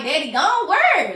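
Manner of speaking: loud, high, drawn-out cry of disbelief; retold panicked outburst; frantic disbelief. Retelling frantic reply to father.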